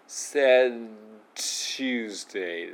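"Said Tuesday" with the D stopped at the tongue tip; held D. Shown as a demonstration. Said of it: "こんな感じね".

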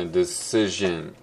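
decision said slowly in English, three syllables de-ci-sion; careful slow English decision; English pronunciation demo. Shown as a demonstration. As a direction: model decision clearly and slowly as an English word. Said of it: "decisionっていう単語ありますね".

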